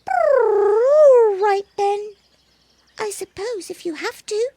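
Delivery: squeaky pleading kitten, high pitched. Acted. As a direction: beg like a little kitten. Kitten reluctant.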